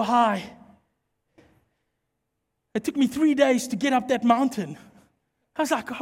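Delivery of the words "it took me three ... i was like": ordinary talk, nothing marked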